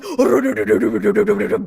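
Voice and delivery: loud rapid rolling du-du-du noise; imitated charging rumble. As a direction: mimic someone barreling in at a run. Imitates sergeant charging over.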